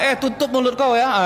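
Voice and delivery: high, sharp shut-up retort; mock angry retort. Spouse telling the other to shut up, mock angry. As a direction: bite back at the spouse.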